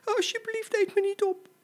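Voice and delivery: tiny, very high pleading squeak; frightened, squeaky little animal begging; trembling little voice. Acted. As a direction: plead for your life as a little baby zebra. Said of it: "met een bibberend stemmetje".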